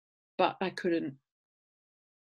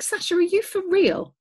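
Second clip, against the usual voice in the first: high, loud incredulous question; mock disbelief; 13 semitones higher, 8 dB louder than usual. Owner to dog refusing her tea, mock incredulous. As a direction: act out exasperated disbelief, pitched right up.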